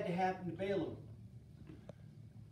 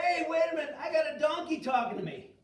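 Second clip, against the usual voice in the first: higher, louder blustering; oblivious Balaam; 6 semitones higher, 5 dB louder than usual. Acted. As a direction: bluster without noticing the miracle. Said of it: "Balaam's still not figuring".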